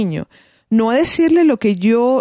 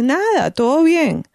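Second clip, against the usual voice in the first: put-on slow, higher, dismissive teen brush-off; lightly voiced bored teenager; bored child; 6 semitones higher, slower than usual. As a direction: shrug the question off like a bored kid.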